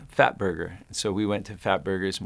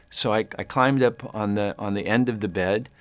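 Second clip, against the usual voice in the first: ordinary talk, nothing marked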